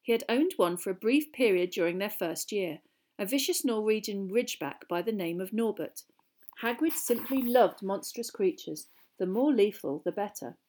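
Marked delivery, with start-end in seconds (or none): none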